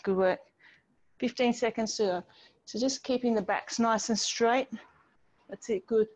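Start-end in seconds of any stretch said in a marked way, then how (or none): none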